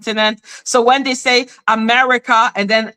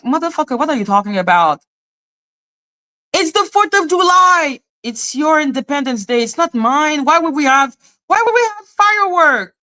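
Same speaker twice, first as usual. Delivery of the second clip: incredulous retort; 6 semitones higher than usual. Retold or imitated. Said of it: "you're just like".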